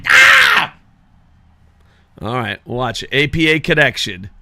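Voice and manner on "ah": high piercing yell; sharp yell. Real, not acted. Gamer's sudden high yell of frustration. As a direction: let out a sudden high cry. Outburst after cursing.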